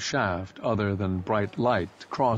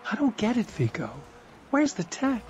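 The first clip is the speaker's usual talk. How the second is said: higher woman's voice, puzzled; Imala, a woman; 13 semitones higher than usual. A narrator's reading.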